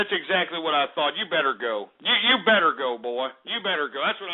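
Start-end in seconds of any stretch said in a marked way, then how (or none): none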